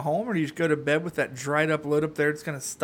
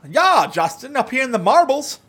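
higher hammed-up Canadian accent; mock Canadian accent; 7 semitones higher, 4 dB louder than usual. To Justin, a put-on Canadian accent. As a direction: play a cartoon Canadian.

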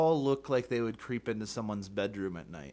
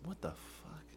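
breathy shocked whisper; 6 semitones higher, 13 dB quieter than usual. Real, not acted. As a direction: whisper it in disbelief. Reacts to odd song title.

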